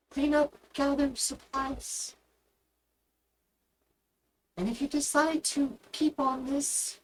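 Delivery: slow, lightly voiced superior officer. Commanding officer granting the crew leave, lightly voiced.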